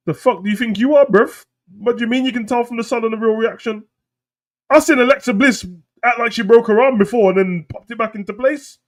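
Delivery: high-pitched, loud indignant rant; hammed-up offended challenge; mock outrage. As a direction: fire back in high, incredulous protest.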